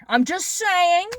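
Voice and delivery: slow and pointed, I'm just saying stretched out; slow pointed aside; drawn-out I'm just saying. Real, not acted. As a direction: lean on "I'm just saying" slowly with a knowing edge. Repeats phrase, first take drawn out.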